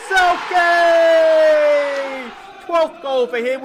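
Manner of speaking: very high, very loud name yell; goal scream. Real, not acted. Goal celebration commentary.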